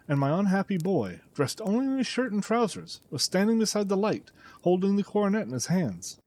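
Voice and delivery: usual speaking voice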